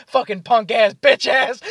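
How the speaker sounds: loud, high mock insult with laughter; imitated roast. Drunk friend finishing off a roast, mock-aggressive. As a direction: shout the last insult through giggles. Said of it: "start roasting each other".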